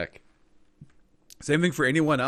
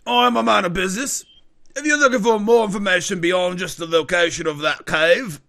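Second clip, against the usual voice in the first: firm, higher dealer naming his price; businesslike informant; 7 semitones higher, 8 dB louder than usual. Acted. Informant charging another hundred gold, firmly businesslike. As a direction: drive a hard bargain in character. Game master voices the informant.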